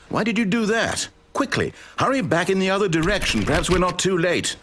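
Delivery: high, urgent pleading; panicked. Acted. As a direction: hurry them back in alarm. Narrator panics at wrong door.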